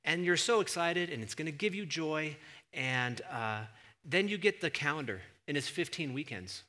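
ordinary delivery

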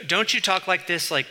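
normal talking voice

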